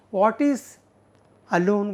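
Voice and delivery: usual speaking voice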